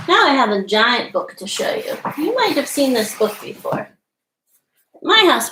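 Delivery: ordinary delivery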